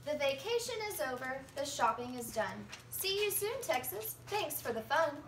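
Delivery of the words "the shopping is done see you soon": plain reading voice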